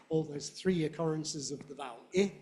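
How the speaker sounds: ordinary delivery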